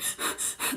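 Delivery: fast, heavy frightened breaths; heavy scared breathing. Shown as a demonstration. Showing what the hidden man sounded like, fast scared breathing. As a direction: pant as if scared stiff. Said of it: "breathe really heavy and fast when you're scared".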